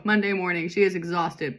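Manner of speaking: ordinary delivery, nothing marked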